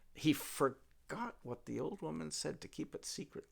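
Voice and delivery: ordinary delivery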